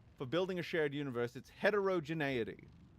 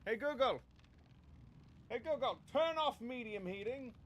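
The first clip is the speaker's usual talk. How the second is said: voice command; 8 semitones higher, 3 dB quieter than usual. Real, not acted. Voice command to speaker.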